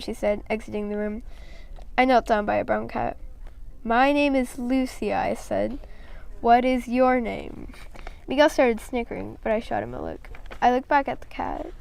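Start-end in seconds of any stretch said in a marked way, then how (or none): 3.8-7.7 slow to a cat. Acted. Speaking slowly to a cat.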